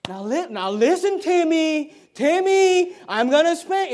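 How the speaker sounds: loud, high, exasperated parent impersonation; mocking a parent who keeps warning Timmy, loud and rising.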